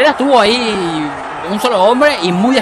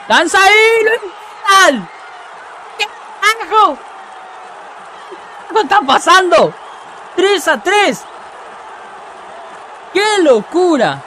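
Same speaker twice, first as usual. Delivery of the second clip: excited shout; 10 semitones higher than usual. Real, not acted. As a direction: shout in disbelief at the comeback. Equaliser in a wild match. (gritos de incredulidad)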